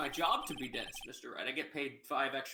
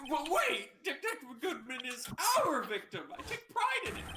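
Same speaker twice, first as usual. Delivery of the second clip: high excited; 10 semitones higher than usual. Acted. Judge voiced, high excited.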